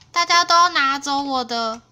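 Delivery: ordinary delivery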